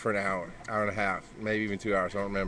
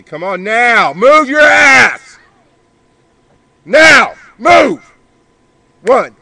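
much higher, very loud drill-sergeant yell; real yelling; 9 semitones higher, 16 dB louder, slower than usual. Real, not acted. Yelling at kids in the pool.

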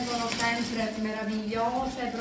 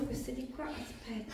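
whispered aside; 3 semitones lower, 12 dB quieter than usual. Real, not acted.

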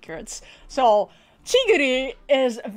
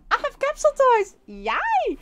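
much higher, fast and gleeful; squealing excitement; 14 semitones higher than usual. Real, not acted. Vlogger revealing capsule toys to viewers, gleeful squeal.

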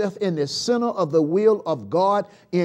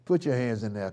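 usual speaking voice

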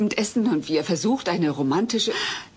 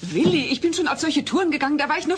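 heated protest; 7 semitones higher than usual. Acted. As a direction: protest at your husband, voice rising. Ehestreit, Kate wird laut. (aufgebracht, höher und lauter)

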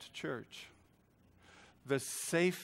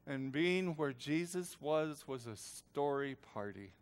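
ordinary talk

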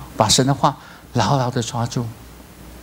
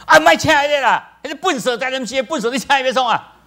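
mocking other voice; 12 semitones higher than usual. A voice in the background scorns him as clumsy, high and mocking. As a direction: sneer it in a high voice. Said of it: "另外一个声安怎讲呢".

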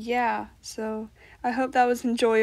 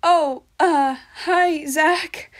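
put-on shy girl voice; 5 semitones higher, 6 dB louder, slower than usual. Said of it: "[name], medium voice".

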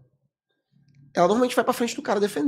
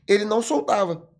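ordinary talk

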